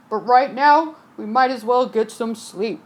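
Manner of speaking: lightly gruff calm captain. Acted. Captain agreeing with the passenger. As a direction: agree like an old sea dog.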